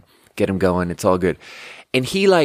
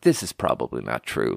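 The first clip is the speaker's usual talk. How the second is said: low, softer private aside; inner doubting voice; 10 semitones lower, 5 dB quieter than usual. Retold or imitated. Doubting their own tidy story, low inner voice. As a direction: drop low like a nagging thought. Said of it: "in the back of my head".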